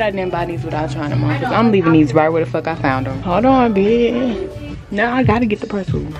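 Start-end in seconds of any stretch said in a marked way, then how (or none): none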